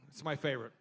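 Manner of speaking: ordinary delivery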